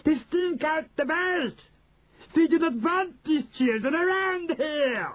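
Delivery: high shrieking witch voice; shrill screeching Grand High Witch. Acted. Witch voice continues.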